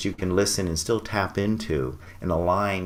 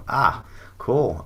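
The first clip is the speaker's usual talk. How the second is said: ordinary talk, nothing marked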